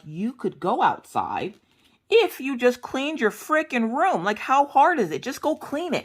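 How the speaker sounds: high-pitched retold scolding; exasperated mother. Mom tells whining daughter to clean room, exasperated high voice.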